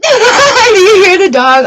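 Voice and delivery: asking through a slow, high laugh; amused laugh at the interruption. Real, not acted. Reader laughing to viewers about her dog, laughing.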